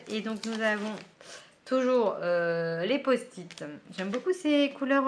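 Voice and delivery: normal talking voice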